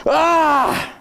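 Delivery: loud high cry of frustration; frustrated yell. Real, not acted. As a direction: let out a loud exasperated shout. Frustrated outburst.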